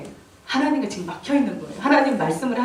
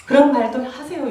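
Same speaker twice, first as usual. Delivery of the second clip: ordinary talk, nothing marked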